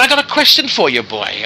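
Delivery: put-on high, loud Welsh accent on question. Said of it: "the Welsh accent".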